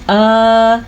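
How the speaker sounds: आ stretched on a level tone; held long aa. Shown as a demonstration. Teacher showing how long khari zabar lasts, आ held. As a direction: hold आ for the full madd length. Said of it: "हम कितनी मिकदार कर सकते हैं".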